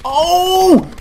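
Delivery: sudden high, loud "oh!"; big high-pitched exclamation; startled yell. Real, not acted. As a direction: yelp out in surprise. Startled yell during match.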